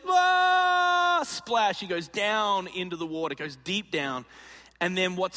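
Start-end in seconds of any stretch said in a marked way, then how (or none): 0.1-1.2 falling yell. Retold or imitated. Friend leaps off the rock.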